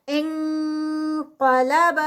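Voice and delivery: انقلب with the نون as ng going straight to ق; nasal ng recitation. Shown as a demonstration. Teacher demonstrates the ng sound, slow. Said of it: "بلکہ اس کو".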